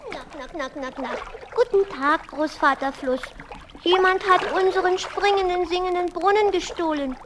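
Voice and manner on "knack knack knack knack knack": soft, low mechanical knack sounds; sputtering motor noise. Acted. The boat Jonathan's motor ticking softly along. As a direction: click out the little motor quietly.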